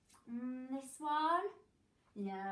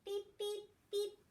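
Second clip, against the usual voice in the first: machine beeps; 9 semitones higher than usual. Pretend.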